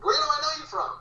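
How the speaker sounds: high, questioning fan voice; puzzled fan. Retold or imitated. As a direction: lightly act out a stranger racking his brain. Fan puzzling over where he's from.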